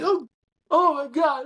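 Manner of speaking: high acted exclamation; retold astonishment. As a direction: react to huge praise in a high voice. Reacting to a huge compliment.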